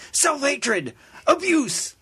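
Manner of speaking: hammed-up high preacher voice; preacher impression. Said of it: "I'm not using his voice again".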